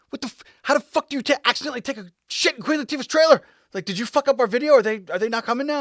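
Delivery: high, loud panicked disbelief. Retold or imitated. Buddy panicking that the video is ruined, high loud alarm.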